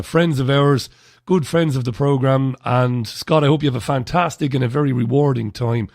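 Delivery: normal talking voice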